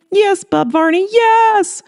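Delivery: overflowing with happy tears; tearful yes. Acted. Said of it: "Gloria cried so hard".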